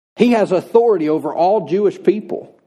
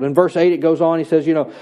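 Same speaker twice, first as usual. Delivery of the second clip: usual speaking voice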